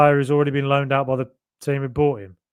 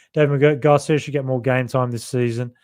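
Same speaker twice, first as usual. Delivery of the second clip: usual speaking voice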